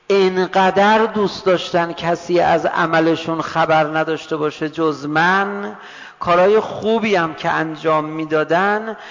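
loud, stretched-out sermon delivery; forceful preaching. Acted. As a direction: proclaim God's words from the pulpit, rising at the end. Preaching God's words. (موعظهٔ بلند و کشیده)

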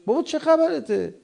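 high-pitched mock protest; exasperated retort. Spouse answering a short-tempered partner, exasperated. Acts a spouse reacting to irritation.

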